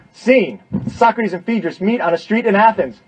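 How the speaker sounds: loud, high scene-setting call; scene announcement. Pretend. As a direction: announce the scene like a stage direction.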